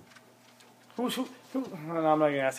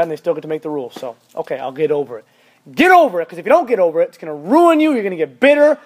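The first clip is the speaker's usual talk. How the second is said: ordinary talk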